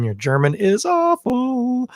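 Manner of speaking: high, drawn-out mock complaint; imagined German listener. Host voicing a German listener judging his accent, high mock complaint. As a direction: stretch it out, climbing into a high held note. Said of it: "any German listeners out there wanna confirm".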